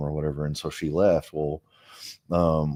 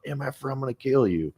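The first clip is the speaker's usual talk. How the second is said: higher-pitched mock threat, not shouted; retold threat; 8 semitones higher than usual. Imitating stepdad raising the shotgun at him, a mild retold threat. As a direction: imitate a threat in a raised pitch, without yelling.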